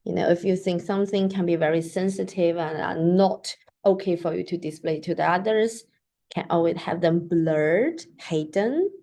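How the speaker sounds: usual speaking voice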